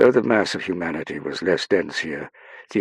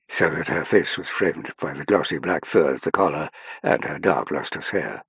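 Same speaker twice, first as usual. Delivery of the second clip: plain reading voice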